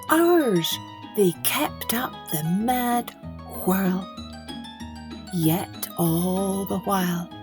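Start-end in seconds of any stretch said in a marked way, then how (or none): none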